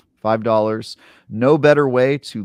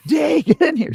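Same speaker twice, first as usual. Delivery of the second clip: high loud call; 5 dB louder than usual. Real, not acted. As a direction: shout her into the room. Calling out to D.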